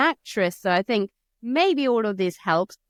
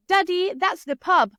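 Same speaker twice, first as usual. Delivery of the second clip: put-on high child voice, pub said the English way; child pointing out the pub to dad, English pronunciation; English-accented pub; 12 semitones higher, 6 dB louder than usual. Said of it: "change the accent".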